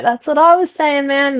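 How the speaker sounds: high-pitched, vindicated outburst; retold exclamation. Listener answering the podcast host, retold excited exclamation. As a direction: pitch up, as if calling back at the radio.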